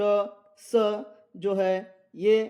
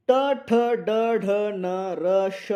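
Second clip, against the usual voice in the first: slow drawn-out recitation; 3 semitones higher, 5 dB louder, much slower than usual. Shown as a demonstration. Teacher reciting letters slowly.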